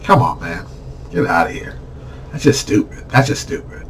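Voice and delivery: soft, under-the-breath disgust; hushed, exasperated aside; muttered dismissal. Real, not acted. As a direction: drop the volume and wave it away. Dismissing bad excuses under breath.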